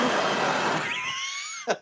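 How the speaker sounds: quick real chuckle; brief laugh; short laugh. Real, not acted. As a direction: chuckle at your own joke. Laughs after his own joke.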